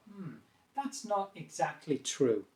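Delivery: hesitant hmm, then slow falling disagreement; downward tone. Shown as a demonstration. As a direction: disagree slowly and gently, tone going down. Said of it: "tone of voice more down".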